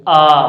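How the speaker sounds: आ said loud and open from the throat; open-throated आ as a vowel demo; demonstrated long aa. Shown as a demonstration. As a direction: stretch आ long from the back of the tongue. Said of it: "बड़ा आ बोल रहे हैं".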